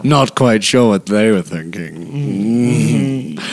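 low, drawn-out sinister professor; Snape. Acted. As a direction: keep it deep and measured, stretching the last phrase. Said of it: "like, a Snape voice".

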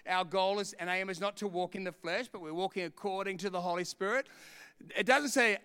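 ordinary talk, nothing marked

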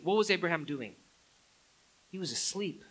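normal talking voice

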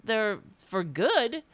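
usual speaking voice

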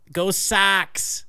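put-on Boston accent. Boston accent guessing game.

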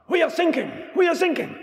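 high, shouted crisp British distress call; shouting British accent. Retold or imitated. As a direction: yell it in a clipped British accent. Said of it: "a crisp British voice".

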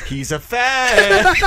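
high, long-drawn mimicked line; hammed-up show quote; quoted TV line. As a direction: stretch it out high, amused. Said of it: "it's a quote from The Sopranos".

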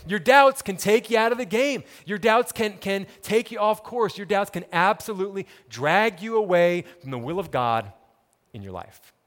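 loud, driving preacher's build; preaching at full force. Acted. Pastor warning the congregation about doubt, preaching hard. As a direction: press the warning home to the congregation.

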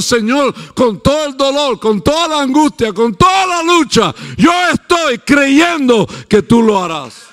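high shouting plea, building; shouted prayer. Retold or imitated. Believer crying out to God in anguish, loud and high. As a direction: cry it out to God. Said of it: "que está parado diciendo".

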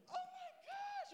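hushed, high-pitched mock squeal; a whole room's excitement squeezed into a tiny whisper-squeal; mock excited gasp. Speaker imitating the whole room at the news, hushed excited gasp. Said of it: "super excited about it".

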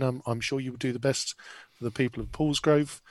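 normal talking voice

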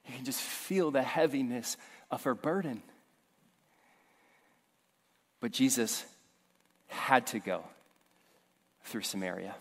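low, quiet, heavy preaching voice; somber sermon storytelling. Acted. Pastor telling the congregation her story, low and heavy.

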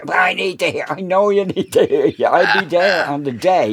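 mock pleading back and forth; retold argument. Quarrel retold with Kiwi.

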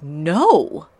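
sharp loud "No!"; a loud, quick refusal; retold shout. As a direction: blurt it out as a protest. Tara objects in retold scene.

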